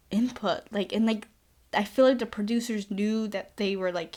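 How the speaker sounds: normal talking voice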